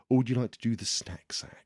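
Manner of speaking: soft unvoiced whisper. Real, not acted. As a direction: breathe it as a whisper. Whispered aside.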